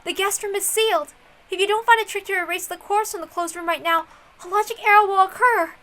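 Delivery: high, bright-edged voice, pleased with her own move; smug detective. Acted. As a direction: lord it over the table with a smile in the voice. Challenges the rival in high voice.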